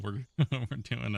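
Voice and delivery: giggling through the words; can't stop laughing. Real, not acted. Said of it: "I can't stop laughing".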